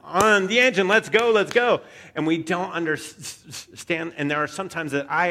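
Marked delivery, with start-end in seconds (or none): 0.0-1.8 high, loud, hammed-up urgency; reckless urging. Reckless Peter urging the driver to rev the engine, loud and high. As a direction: egg them on impatiently. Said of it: "he is the person who's just like".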